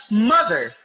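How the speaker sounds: "mother" as MO-ther, first syllable punched; stress on first syllable. Shown as a demonstration. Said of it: "Rather, you pronounce it as".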